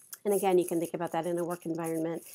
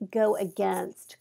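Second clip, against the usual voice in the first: ordinary talk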